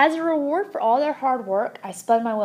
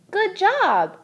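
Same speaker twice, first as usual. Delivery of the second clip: high, louder praise; encouraging; 9 semitones higher, 5 dB louder than usual. A narrator's reading. Hamster praising Saya's mom, high and bright. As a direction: cheer her on. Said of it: "I said".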